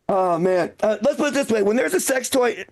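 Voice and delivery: ordinary talk, nothing marked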